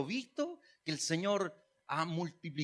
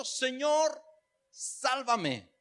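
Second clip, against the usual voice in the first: raised, high-pitched call for rescue; crying out; 5 semitones higher, much slower than usual. Retold or imitated. Preacher voicing sinking Pedro calling to the Lord, crying out. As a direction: call out as in a retold plea. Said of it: "dio voces diciendo".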